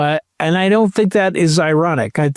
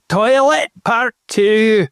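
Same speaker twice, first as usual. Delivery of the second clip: Scottish accent; 7 semitones higher, slower than usual. Shown as a demonstration. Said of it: "say it in a Scottish accent".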